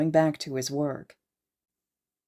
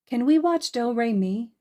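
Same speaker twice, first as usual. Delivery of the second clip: put-on little girl voice; 7 semitones higher, 3 dB louder than usual.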